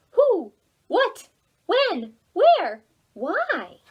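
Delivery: high, loud little owl questions; curious baby owl. Acted. Baby owl Oliver firing off his questions, high and loud. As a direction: pipe up like an eager owlet. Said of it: "he asked other kinds of questions".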